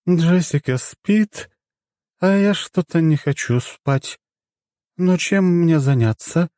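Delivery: high, slow, bored tipsy musing; drunk goddess voice. Acted.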